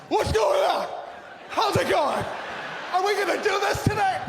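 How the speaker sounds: high, loud hyped-up gym greeting; mock an over-eager spin fan shouting hello; fake positive gym-goer. Gym-goers entering class, loud fake-positive shout. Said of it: "everybody's fake positive".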